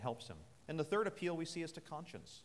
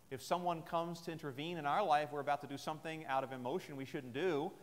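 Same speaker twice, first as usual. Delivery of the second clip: usual speaking voice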